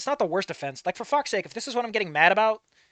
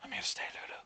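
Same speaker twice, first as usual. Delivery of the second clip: whisper; 15 dB quieter than usual. A narrator's reading. Tender reassurance whispered in story.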